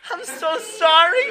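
high, loud wife bursting into laughter; slow, squealed sorry through laughter; laughing apology. Acted. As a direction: act out a laughing, oblivious apology. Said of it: "She starts laughing".

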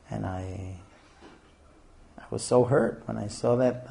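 soft, halting, wounded confession; acted hurt. Shown as a demonstration. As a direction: haltingly tell your wife how hurt you were. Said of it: "talk hurt".

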